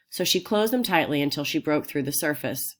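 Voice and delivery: ordinary delivery, nothing marked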